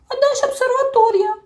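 high, loud nagging question; a little creature who won't stop asking; pestering. A narrator's reading. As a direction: keep pestering. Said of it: "не вгавав Чмих".

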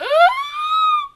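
very high squeal of delight; excited squeal. Real, not acted. Fan squealing at a favourite scene, high delighted squeal.

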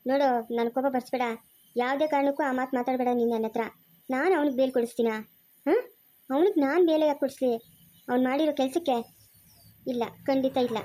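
normal talking voice

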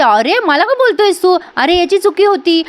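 mock-hurt protest; indignant protest. Heroine protests to her brother.

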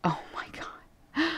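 slow breathy whisper, rising at the end; hushed disbelief; awed whisper. Real, not acted. Staring at her late pug's portrait, whispering in awe.

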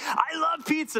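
put-on a child raving about pizza; kid gushing. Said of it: "They're like".

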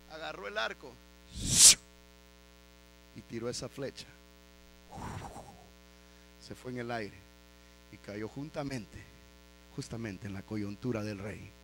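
low, soft, suspenseful narration; hushed storytelling. Real, not acted. Preacher telling how the arrow found the king, hushed storytelling. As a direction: drop low and let each phrase hang.

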